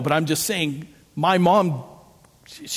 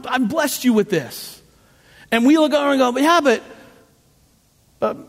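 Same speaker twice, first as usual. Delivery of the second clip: loud, high and driving, preaching hard; full-force preaching; 8 semitones higher, 4 dB louder than usual. Acted. Pastor preaching to his congregation, loud and raised. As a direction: preach it out big to the room.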